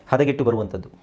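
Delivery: ordinary delivery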